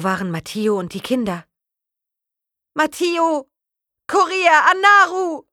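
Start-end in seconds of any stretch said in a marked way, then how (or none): 2.7-3.5 high, loud cry of Matteo!; desperate call. Acted. Aroa calling for Matteo after the crash, desperate shout. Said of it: "nach den anderen zu rufen". 4.1-5.5 high, loud desperate shouts; calling out. Acted. Aroa calling for her lost children, desperate shouting. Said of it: "nach den anderen zu rufen".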